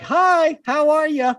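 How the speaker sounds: high, cheery greeting in imitation. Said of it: "And [name] is like".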